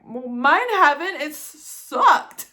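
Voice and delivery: sarcastic retort. Retold or imitated. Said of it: "And I'm like".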